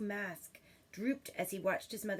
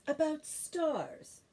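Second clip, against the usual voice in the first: gentle; 7 semitones higher, much slower than usual. Acted. Mother, slow teasing.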